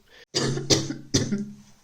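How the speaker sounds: long harsh unvoiced cough; sick person's loud coughing fit; real coughing fit. Real, not acted. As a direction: let the cough take over. Coughing while sick with covid.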